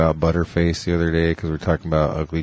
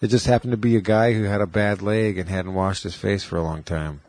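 normal talking voice

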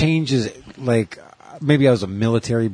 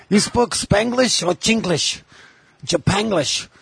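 hammed-up Chinglish caricature, pitched way up; mock Chinglish accent; 9 semitones higher than usual. Riffing on Chingland, exaggerated mock Chinglish accent. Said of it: "they call that Chingland".

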